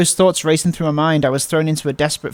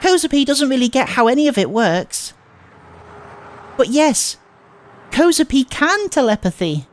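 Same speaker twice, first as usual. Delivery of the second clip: put-on cutesy girl voice; 9 semitones higher than usual. Transfer student soothing Nishizuo-kun, high cutesy baby talk.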